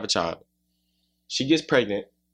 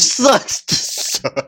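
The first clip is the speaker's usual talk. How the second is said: mocking tease; 8 semitones higher, much slower than usual. Real, not acted. Teasing the others.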